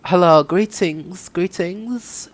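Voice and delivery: high, slow put-on German-accented woman's voice; German-accented character voice, drawn out and high; Von Karma. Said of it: "do the Von Karma voice".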